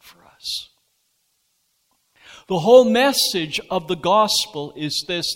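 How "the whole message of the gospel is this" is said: proclaiming. Real, not acted. Preacher proclaims the main point.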